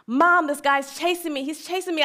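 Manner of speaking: high, loud, panicked cry for mom; frantic screaming. Retold or imitated. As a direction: retell a terrified child screaming into the phone. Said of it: "and just screaming".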